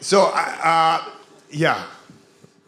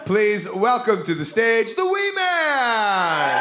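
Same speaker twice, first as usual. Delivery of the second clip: announcer introduction; 6 semitones higher, much slower than usual. Acted. Introduces the guest on stage.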